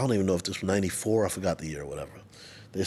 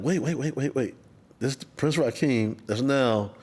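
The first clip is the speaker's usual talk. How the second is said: excited realization; 6 semitones higher, slower than usual. Retold or imitated. Realizes [name] is in Wu-Tang.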